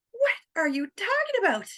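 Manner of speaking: high, disbelieving question; can't believe what you hear; replying to the friend in high disbelief; incredulous. Retold or imitated.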